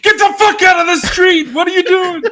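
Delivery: high, loud furious New York shout; New York yell. Retold or imitated. Streamer acts out New Yorkers yelling at him in Times Square. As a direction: bark it like an angry New Yorker. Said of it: "everyone's like".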